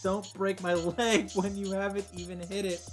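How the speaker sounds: usual speaking voice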